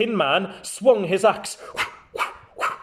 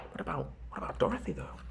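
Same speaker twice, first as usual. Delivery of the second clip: 1 whispered aside; 4 semitones higher, 16 dB quieter, much faster than usual. Real, not acted. Narrator aside, worried.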